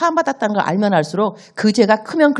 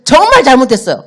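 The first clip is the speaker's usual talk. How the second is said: louder, urgent pleading apology; desperate apology; 5 semitones higher, 9 dB louder than usual. Acted. Child pleading with father again, loud and desperate. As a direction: beg him again, almost crying out.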